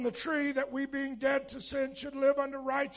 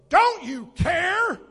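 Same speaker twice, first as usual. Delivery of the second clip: loud, slow, pleading shout; desperate cry; 5 semitones higher, 8 dB louder, slower than usual. Retold or imitated. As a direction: shout it like men in a sinking boat. Disciples cry out in storm.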